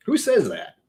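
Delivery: normal talking voice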